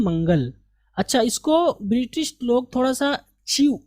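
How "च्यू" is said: च्यू begun with a "ch" sound; British "chyoo" for च्यू; British pronunciation. Shown as a demonstration. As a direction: say च्यू like "chew", British style. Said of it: "चा की आवाज़ के साथ".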